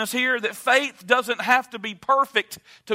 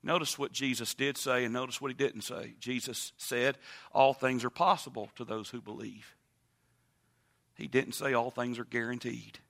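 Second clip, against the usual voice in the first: much softer and lower, intimate; hushed preaching; 9 semitones lower, 9 dB quieter than usual. Acted. Preacher speaking to the congregation in a low, hushed, intimate voice. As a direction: drop to a near hush and draw them in.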